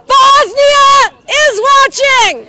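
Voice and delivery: slow, high, full-throated shout. Real, not acted. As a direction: bellow it across the square. Calling out to distant crowd.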